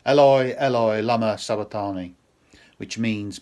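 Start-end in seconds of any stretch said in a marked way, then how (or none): 0.0-0.5 cried out high and loud in anguish; loud cry. A narrator's reading. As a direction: call it out with full voice, crying to God. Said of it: "Jesus cried out in a loud voice".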